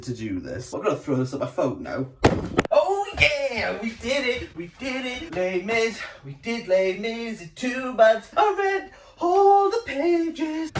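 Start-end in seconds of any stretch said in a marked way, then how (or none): 2.7-10.7 high, bright cheers of victory; triumphant. Real, not acted. Celebrating finishing Les Mis, triumphant shout. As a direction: celebrate finishing the book, shouting the last line.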